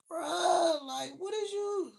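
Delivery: very high squeaky disbelief; teen reacting in disbelief to the cop, high pitched; incredulous. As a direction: act floored by the question.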